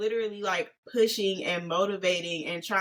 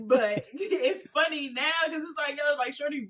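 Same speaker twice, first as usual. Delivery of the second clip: high, slow, laughing words; cracking up; 6 semitones higher than usual. Real, not acted. Friend admitting it's funny now, cracking up. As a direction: giggle through the explanation. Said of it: "I'm cracking up 'cause now it's funny".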